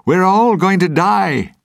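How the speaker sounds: high, loud terrified yell; a sailor's frantic cry of doom; panicked shout. A narrator's reading. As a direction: scream it like the end has come. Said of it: "he shouted".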